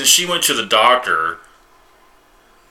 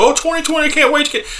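high mocking tone of optimists; squeaky imitation of hopeful people; 5 dB louder than usual. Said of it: "Why do y'all keep talking about".

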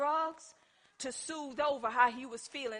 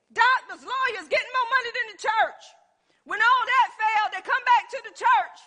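high, loud, fired-up preaching; preaching at full force; 10 semitones higher, 9 dB louder than usual. Real, not acted. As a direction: rouse the congregation, laughing between lines. Preacher ramps up.

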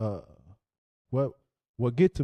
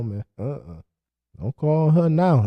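normal talking voice

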